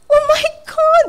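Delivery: high squealing "Oh my God!"; shrieking in amazement; impressed client gushing. Retold or imitated. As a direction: gasp it out like a starstruck lady. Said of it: "Ganun siya".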